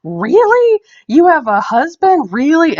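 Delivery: high, loud "Really?" in mock shock; shocked disbelief. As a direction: play the stunned man at the bar who can't believe it. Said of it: "kinda shocked".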